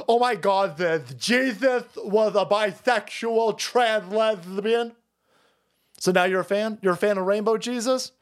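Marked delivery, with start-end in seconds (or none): 0.1-4.9 higher, slow mocking gush; hammed-up progressive preaching; mock activist. Mocking people who lecture about Jesus, higher slow gush. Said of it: "Like these people that go".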